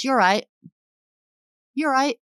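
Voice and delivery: casual English "You're right" run together; light English accent greeting; British greeting. Shown as a demonstration. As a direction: say "You're right" like a quick UK hello. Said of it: "the common greeting is".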